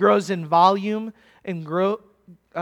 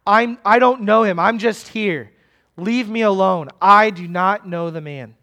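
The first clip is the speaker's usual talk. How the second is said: loud, insistent, exasperated outburst; growing passionate denial; 5 dB louder than usual. Acted. Peter distancing himself from Jesus before bystanders, forceful denial. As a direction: push them away with firm protest. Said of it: "with growing volume, with growing seriousness".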